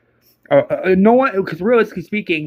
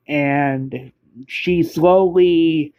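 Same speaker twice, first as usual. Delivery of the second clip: drawn-out slowly; 2 semitones lower, much slower than usual. Acted. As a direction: stretch the word slowly out very slowly. Word slowly stretched out.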